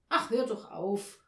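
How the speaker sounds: loud, irritated brush-off; snapping. A narrator's reading. Mother to Olaf who tries to comfort her, snapping. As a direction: snap at him to shut him up. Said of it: "fuhr Frau Janka ihn an".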